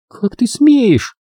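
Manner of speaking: high, drawn-out outrage; indignant girl's exclamation, slow and high. A narrator's reading. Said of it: "возмутилась Рен".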